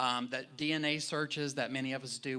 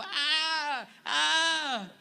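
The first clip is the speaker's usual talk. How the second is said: very high mock shriek back and forth; E.T. scream; 7 dB louder than usual. Recalling the E.T. scene to the congregation, mock shriek. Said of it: "E T and the little girl go".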